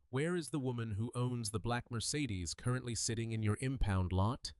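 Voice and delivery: soft, menacing threat; menacing quiet. Acted. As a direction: lean in and threaten softly. Chief threatens leaning over counter.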